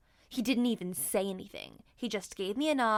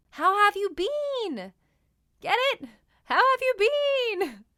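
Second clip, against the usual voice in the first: much higher, louder, goofy pun delivery; punny joke voice; 11 semitones higher, 7 dB louder than usual. Acted. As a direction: sell the bean pun, hammed up. Cracking a bean pun.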